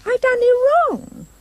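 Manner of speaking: very high, pleading wail; a high frightened girl's plea; crying out. A narrator's reading. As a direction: beg desperately. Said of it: "She cried again".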